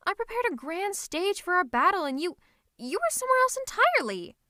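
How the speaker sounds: loud, high-pitched indignant complaint; exasperated outburst. Acted. Villain stood up for their grand battle, exasperated outburst. Scolding nemesis who missed the battle.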